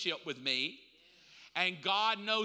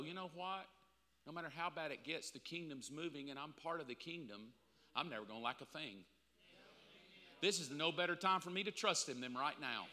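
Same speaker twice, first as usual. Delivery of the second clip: quiet inner resolve; 3 semitones lower, 8 dB quieter, faster than usual. Retold or imitated. Inner voice choosing trust.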